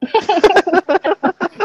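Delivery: big, high-pitched burst of laughter; real high cackling laughter; loud high laugh. Real, not acted. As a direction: crack up loudly at a silly joke. Cracking up at toilet joke.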